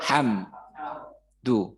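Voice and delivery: Alhamdu stretched out very slowly; slow teaching read of Alhamdu; slow drawn alhamdu. Shown as a demonstration. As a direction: draw out each syllable of Alhamdu. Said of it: "Oke, bisa ya".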